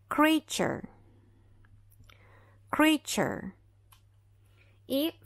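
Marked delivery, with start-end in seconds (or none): 0.1-3.5 creature with ch sound; kree-cher, t as ch; English model word. Shown as a demonstration. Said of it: "esse T vai ser pronunciado como C H".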